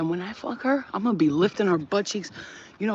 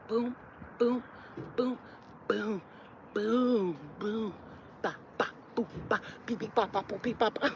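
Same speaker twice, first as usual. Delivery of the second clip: rhythmic beatbox; 3 semitones higher, 9 dB quieter than usual. Shown as a demonstration. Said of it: "rhythmic sound demo".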